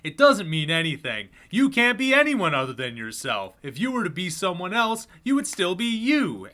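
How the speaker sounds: salesman voice. Acted. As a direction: sell it like a late-night TV pitchman.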